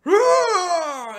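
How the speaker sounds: high, loud charging yell; battle cry. Retold or imitated. Hero charges the witch with a high loud battle yell. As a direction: scream as you launch into the fight.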